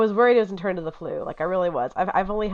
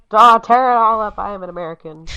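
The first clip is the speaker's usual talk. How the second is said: mock American; 3 semitones higher, 12 dB louder than usual. Mocking construction workers.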